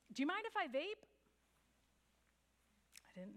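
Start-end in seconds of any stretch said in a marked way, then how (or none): 0.1-1.0 higher, louder question thrown across a room; calling across the room. Retold or imitated. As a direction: ask it loudly from far away. Coworker calls question across room.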